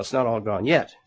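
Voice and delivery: ordinary talk, nothing marked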